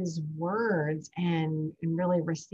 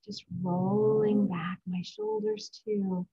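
gentle mindfulness cue, unhurried; guided breathing. Acted. Leads a breathing exercise.

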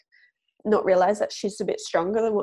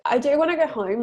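usual speaking voice

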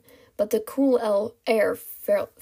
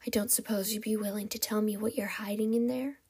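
soft, breathy sly request; ghostly whisper; 7 dB quieter than usual. Acted. Kidnapper asks captive for her secret, ghostly whisper. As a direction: coax her in a hushed villain voice. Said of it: "the ghostly whisper".

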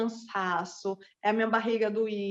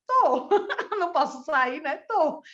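high, louder, laughing through it; laughing reply; 5 semitones higher, 3 dB louder, slower than usual. Retold or imitated. Answering the student's text, laughing.

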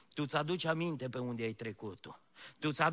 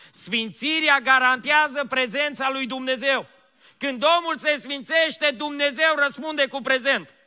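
full-force preaching; 9 semitones higher, 10 dB louder than usual. Acted. Pastor urging the church to holiness, full-force preaching. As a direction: proclaim it to the whole church at full voice.

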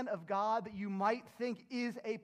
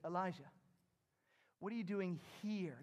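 gentle, close question; 2 semitones lower, 5 dB quieter than usual. Acted. God asks Elijah in the cave, gently and softly. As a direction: ask it quietly, as if right beside him. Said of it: "could have said it that way".

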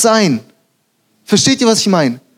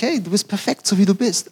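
softer, lightly lifted, reassuring tone; sanfter Zuspruch; 6 dB quieter than usual. Acted. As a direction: reassure someone gently. Voicing kind alternative to harsh rebuke. (sanft und leise ermutigend)

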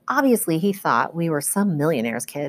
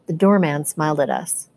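ordinary delivery, nothing marked

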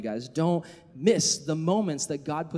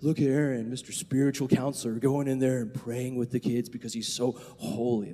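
lower, put-on pious admiration; mock your own holy self-importance; sarcastic awe in an altered voice; 3 semitones lower than usual. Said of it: "That was kind of my mindset".

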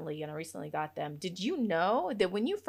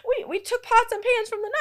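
high, loud, laughing through the words; giggly high-pitched confession; 14 semitones higher, 6 dB louder than usual. Real, not acted. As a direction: crack up while explaining yourself. Laughing about her family's old cookware.

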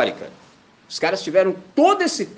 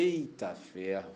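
softer and slow, resigned; exasperated mutter; 9 semitones lower, 13 dB quieter than usual. Retold or imitated. Pastor reacting to the stranger's disbelief, resigned. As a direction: sigh it out low and slow.

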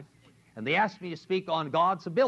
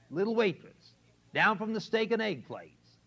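ordinary talk

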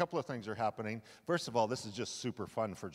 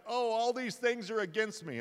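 put-on much higher, whining old father; freaked-out Jacob; 10 semitones higher, 3 dB louder than usual. Jacob hearing the brothers' story, high mock wail. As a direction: wail it like a self-pitying dad. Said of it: "Dad's freaked out".

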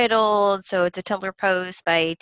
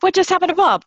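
mock news anchor; 12 semitones higher, 8 dB louder, faster than usual. Playing along as news anchor.

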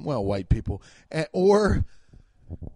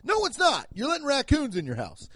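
indignant rebuttal; 8 semitones higher than usual. Acted. Indignant rebuttal of the silly idea.

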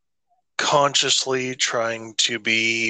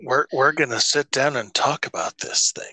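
whispered invite; 2 semitones higher than usual. Retold or imitated. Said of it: "It's like".

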